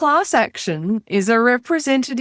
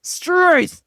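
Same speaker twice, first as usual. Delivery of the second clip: loud, higher broad Aussie "Struth"; ocker exclamation; 7 semitones higher, 5 dB louder than usual. Pretend. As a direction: exclaim like an outback bloke. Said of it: "[name]'s alter ego".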